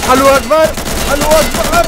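mock battle cry. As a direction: yell it charging in, hammed-up. Charging battle cry joke.

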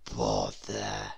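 low, soft disappointed grumble; grumpy crocodile. A narrator's reading. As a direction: sulk at the lost meal. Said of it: "said the crocodile".

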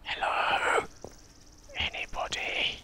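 hushed call into the empty town; whispered call. Real, not acted. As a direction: call out but barely above a whisper.

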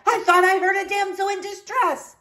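high, put-out knight; indignant surprise. A narrator's reading. Knight finding niece instead of damsel, indignant surprise. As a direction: protest in high-pitched disbelief.

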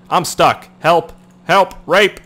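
high, loud short cries; mock cry for help. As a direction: call out in hammed-up distress. Mock cries for rescue when stuck.

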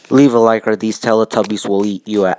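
mock threat yell. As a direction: bellow it like a joking scare. Closing mock threat to viewers.

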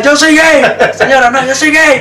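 high-pitched mock cry of protest; comic retold outcry, pitch way up; retold yelling. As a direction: yell it as a desperate excuse, hammed-up. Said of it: "Le empecé a gritar".

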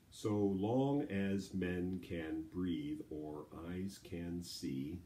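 slow, every syllable separated evenly; measured, drawn-out syllable count. Shown as a demonstration. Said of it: "Let's do the last two lines".

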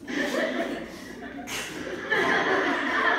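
airy burst of laughter; wheezy giggle fit; breathless laugh. Real, not acted. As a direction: cracks up, almost silent. Owner cracks up at pet.

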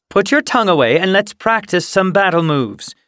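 brisk, loud, high command; projected, high-pitched order; calling out. A narrator's reading. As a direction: summon a trainee to practice from afar. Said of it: "Dawnstripe called from the camp entrance".

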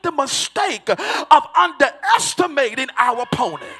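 loud, ringing preacher's shout over music; hollering sermon cadence building to a peak; full-force preaching. Acted.

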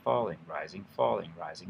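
Falling dropping, rising climbing, back and forth; alternating tones. Shown as a demonstration. Teacher drilling falling against rising, alternating pitch. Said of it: "Just practice saying these things in English".

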